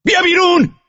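barked order. A narrator's reading. Driver orders the guard out.

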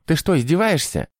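high-pitched indignant outburst; angry. A narrator's reading. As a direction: explode at a useless underling. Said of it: "рявкнул инквизитор, наливаясь кровью". (гневно, высоким голосом)

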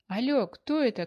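loud, high phone call over noise; shouting into the phone. A narrator's reading. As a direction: shout into the receiver over the din. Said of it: "кричала Аня в трубку".

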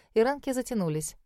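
ordinary delivery, nothing marked

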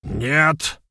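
loud, high cry of protest. A narrator's reading. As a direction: shout the denial sharply. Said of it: "выкрикнул Вернедуб". (громкий выкрик протеста)

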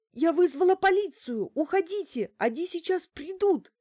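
high, loud desperate cry; tearful shouting. A narrator's reading. Bankina shouting at the intruder through the door, tearful. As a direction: cry out high, warding him off. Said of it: "отдалённый крик, смешанный с плачем".

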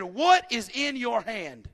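booming, slow divine question; voice of God. Acted. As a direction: let God's question land slowly. God's question to Moses.

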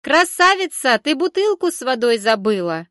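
high, loud wailing call; calling out loudly. A narrator's reading. Vendor calling the girl back, high loud hollering. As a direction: holler after her across the stall. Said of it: "заголосила торговка".

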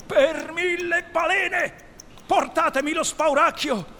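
loud, high captain's bellow; furious command. Acted. Hook demands the bogey be brought out, furious shout. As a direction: roar the oath and order the crew, high and loud. Enraged captain orders his crew.

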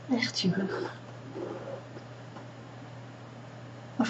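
calm them down gently, close in; guided meditation. Acted. Leads a meditation. (rustige meditatiestem)

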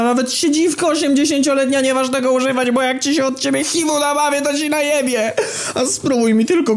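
very high squealing rant; hammed-up hysterical stream of abuse at shrieking pitch; shouted tirade. A narrator's reading. Kamil cursing out an old woman, high-pitched screeched tirade. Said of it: "wstał i krzyknął najciekawszą wiązankę".

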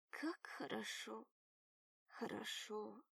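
slow, soft, drifting off; fading into sleep, half murmured; drowsy fading murmur. A narrator's reading. Drifting off, drugged.

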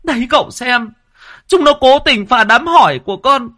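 very high-pitched indignant ranting; high, loud, furious woman's complaint; outraged wife. A narrator's reading. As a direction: rail shrilly at the wreckers, demanding punishment. Red-faced wife demands punishment.

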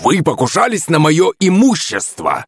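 put-on huffing, furious old man; high, indignant roar; growled accusation. A narrator's reading. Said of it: "прорычал старикан, пыхтя как паровоз".

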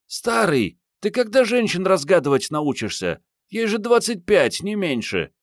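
high-pitched woman teasing a colleague; Mariette, scoffing. A narrator's reading. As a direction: pitch her far up, mocking his cluelessness. Mariette snorts and teases the older man. (высокий женский голос, насмешливо поддевает)

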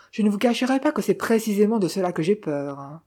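ordinary delivery, nothing marked

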